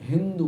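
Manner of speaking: হিন্দু with an h in place of the s of সিন্ধু; lightly voiced foreigner's হিন্দু; foreign mispronunciation. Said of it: "সিন্ধু নদের বাসী বলতে পারত না".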